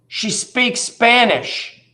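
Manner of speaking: put-on high, slow little-kid voice; a child's small high voice with the punchline drawn out; imitating a child. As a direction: say it like a deadpan seven-year-old. Retells a seven-year-old's answer.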